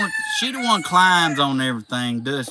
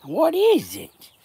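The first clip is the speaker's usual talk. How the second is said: a slow, high coo to a goat; cooing to pet; 14 semitones higher, slower than usual. Real, not acted.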